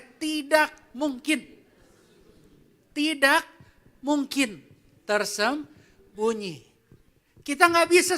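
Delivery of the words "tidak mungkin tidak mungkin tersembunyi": "Tidak mungkin" slow and deliberate, pausing between words; slow emphatic line. Shown as a demonstration. Pastor leading the congregation to repeat after him, slow and emphatic. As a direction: spell it out word by word. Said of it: "Katakan sama saya".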